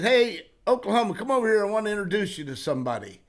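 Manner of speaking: loud hearty call, then quick rattled intro; hollering a buddy over, then rushing the introduction; calling out across the room. Retold or imitated. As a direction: friendly shout over to a pal. Carny calling over Oklahoma Red.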